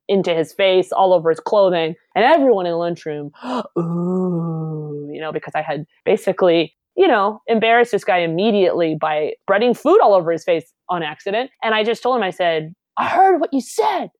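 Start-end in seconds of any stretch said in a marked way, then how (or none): 3.8-5.1 gasp, then a long drawn-out ooh; crowd's shocked ooh. Retold or imitated. Imitates the lunchroom's gasping reaction. 13.0-14.1 high-pitched accusing challenge; retold showdown, voice pitched way up; confronting. Retells confronting the bully.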